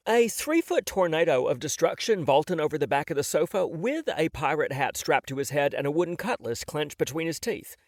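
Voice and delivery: ordinary delivery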